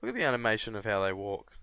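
ordinary talk

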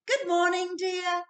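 a chirpy wife waking her husband; cheerful Missus Bear. A narrator's reading. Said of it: "she said".

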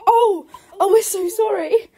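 high, slow flustered apology; apologetic tourists. Retold or imitated. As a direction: gush sorry like embarrassed Brits. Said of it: "And we're like".

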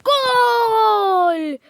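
loud, high triumphant yell; real goal celebration; goal shout. Real, not acted. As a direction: scream the goal out in relief. Finally scores a goal.

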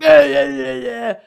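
high, loud garbled yammering; hammed-up yelled babble; mock protesting. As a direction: imitate a blustering tantrum. His wordless protest as she goes.

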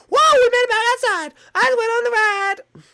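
put-on loud, high triumphant kid; hammed-up celebrating child; excited shout. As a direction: shout in play-acted triumph. Child celebrates getting back outside.

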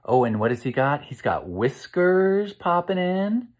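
ordinary delivery, nothing marked

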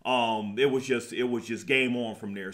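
normal talking voice

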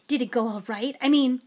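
eager question, then catching himself. A narrator's reading. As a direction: ask keenly, then break off. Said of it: "said Mister Weasley eagerly".

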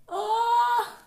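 high, loud, slow triumphant aleluia; relieved cry. Real, not acted. Mask finally off, high triumphant relief. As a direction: celebrate the mask finally coming off.

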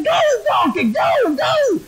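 very high, straining shout at a stubborn animal; acted frantic yell. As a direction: yell it as the exasperated rider, pitch climbing. Balaam urging the donkey while beating.

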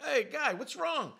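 loud, high call over the bushes. Retold or imitated. Man calls to a neighbour behind the bushes, loud and high.

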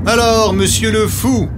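high, taunting jeer; mocking villager. A narrator's reading. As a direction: taunt the fool. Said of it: "les moqueries des villageois".

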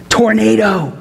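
ordinary talk

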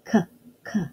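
K, k as a short repeated k sound; teacher sounding out the letter. Shown as a demonstration. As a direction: isolate the k sound. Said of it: "What's the first sound".

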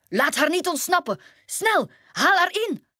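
hoog en luider jagend geroep naar de vrienden; Grumpy jaagt de anderen op; roepen. Acted. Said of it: "riep hij naar zijn vrienden". (Grumpy roept zijn vrienden hoog en luid op)

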